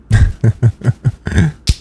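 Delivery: short sly chuckle; teasing chuckle. Acted. Boss laughs at her obedient employee, a sly chuckle.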